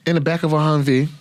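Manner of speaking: normal talking voice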